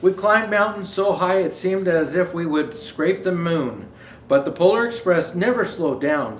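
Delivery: put-on storybook reading for children; gentle read-aloud to kids; story narration. Reads picture book aloud.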